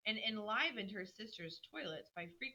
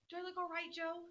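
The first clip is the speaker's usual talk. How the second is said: in a flutter; 10 semitones higher than usual. Acted. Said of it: "Cried Meg in a flutter".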